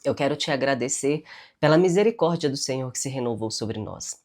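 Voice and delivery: ordinary talk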